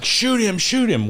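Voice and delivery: high-pitched, imitated cry to fire; mock-urgent high yell without much volume; urgent retold plea. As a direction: re-enact a panicked order, pitch jumping up. Retold urgent order in a struggle.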